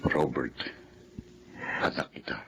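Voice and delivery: hushed, tender whisper. Acted. Father telling his son he is his child, whispered. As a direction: whisper it to him gently.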